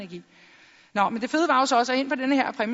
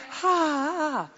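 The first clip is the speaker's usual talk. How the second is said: imitated laugh; 3 semitones higher, much slower than usual. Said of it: "Han sagde".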